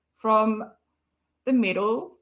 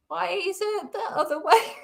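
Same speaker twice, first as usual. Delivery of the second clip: asked through real laughter, higher; laughing; 7 semitones higher than usual. Real, not acted. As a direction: giggle through the question. Confused by tears, laughing.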